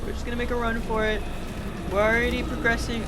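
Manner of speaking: squeaky panicked rush; being chased. Real, not acted. Fleeing a monster in game.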